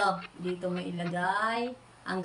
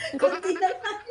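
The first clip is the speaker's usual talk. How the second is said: laughing; 9 semitones higher, 5 dB louder than usual. Real, not acted. Tickling game, laughing.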